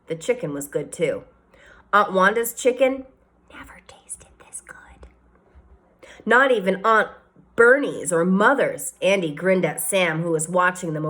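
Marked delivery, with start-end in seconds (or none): 3.5-5.1 whisper. A narrator's reading.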